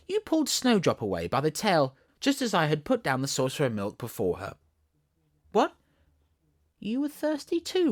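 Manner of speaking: Alice mock scolding. Alice telling the kitten how angry she was, playful high mock scold.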